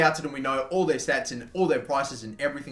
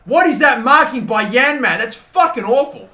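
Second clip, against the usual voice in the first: loud, high outraged yell; 8 semitones higher, 9 dB louder than usual. Real, not acted. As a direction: shout at the defender's awful marking. Yelling at bad defending.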